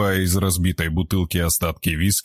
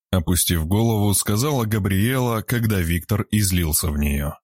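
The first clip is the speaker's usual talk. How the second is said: ordinary delivery, nothing marked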